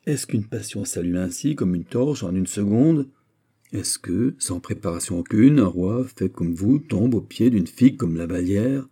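ordinary delivery, nothing marked

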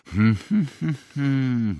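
high, drawn-out "hmm"; sceptical humming murmur. A narrator's reading. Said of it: "mruknął pan Oliwander". (podejrzliwe, wysokie mruczenie)

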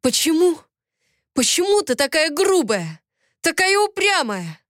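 high, pleading angry cry; exasperated outburst. A narrator's reading. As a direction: demand it, voice climbing high. Bob yelling at the heroine.

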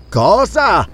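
high burst of surprise; shocked exclamation. A narrator's reading. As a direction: blurt it out in alarm. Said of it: "L'esclamazione di sorpresa proruppe".

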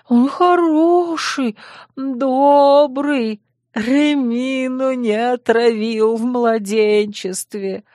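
put-on high, slow childish lunatic voice; babbling madman. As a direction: coo about yourself like a crazy child.

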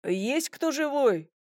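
high, slow searching call. A narrator's reading. Major calls into a seemingly empty house, high and slow. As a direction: draw it out, checking who is home.

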